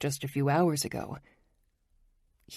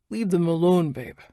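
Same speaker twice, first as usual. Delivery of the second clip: quip; 3 semitones higher, 5 dB louder than usual. A narrator's reading. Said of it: "Nick quips".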